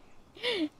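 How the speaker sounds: short high laugh at herself; embarrassed laugh. Real, not acted. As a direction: laugh off the mistake. Laughs at own mispronunciation.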